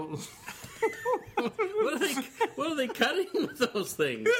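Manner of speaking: high-pitched, words breaking into laughs; helpless laughter. Real, not acted. Asking partner about the useless knives, helplessly laughing. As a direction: ask it while cracking up.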